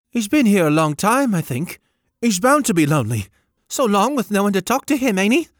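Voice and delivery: put-on high, eager child voice; young boy voice. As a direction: pipe it up like a small boy. Boy speaks.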